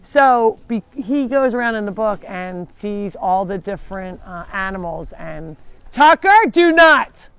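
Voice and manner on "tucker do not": loud, high command to a dog; real raised-voice warning; sharp scolding. Real, not acted. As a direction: break off and shout the dog down. Scolding dog Tucker mid-sentence.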